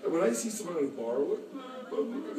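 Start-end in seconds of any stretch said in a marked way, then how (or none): 1.5-2.4 much higher, dopey put-on voice; mock Bud Light order. Mocking a stranger ordering Bud Light in a much higher voice. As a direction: mimic a clueless drinker ordering.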